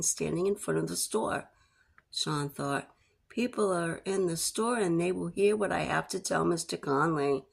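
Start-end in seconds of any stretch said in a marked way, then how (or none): none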